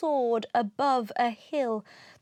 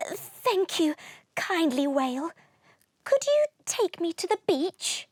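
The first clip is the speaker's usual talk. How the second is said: little dragon voice; 6 semitones higher than usual. Acted. Little dragon thanks the whale.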